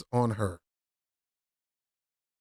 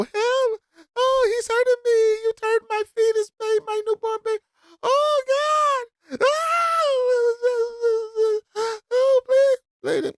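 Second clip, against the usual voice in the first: much higher, hammed-up whimpering Karen; mock Karen fake crying; 4 dB louder than usual. Commentator mocks the woman's fake tears, high hammed-up whimper. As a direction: fake a tearful victim wail, over the top. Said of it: "fake crocodile tears and your threatening".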